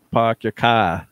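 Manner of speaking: dropped R's, Boston "pahk yah cah"; Boston accent. Retold or imitated. Showing how someone from Boston talks, Boston accent. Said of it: "if you're from Boston".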